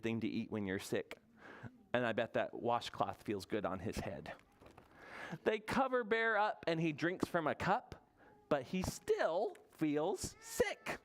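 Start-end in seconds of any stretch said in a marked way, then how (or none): 5.4-11.0 slow, drawn-out storybook narration; reading to children. A narrator's reading. Storyteller reads about sick Bear, slow picture-book reading. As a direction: read it slowly, stretching the key words.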